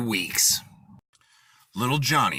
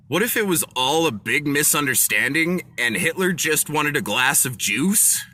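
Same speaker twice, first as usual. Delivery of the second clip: normal talking voice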